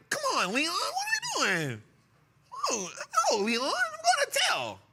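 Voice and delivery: high mock whiny tattletale. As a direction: whine like a kid about to tell.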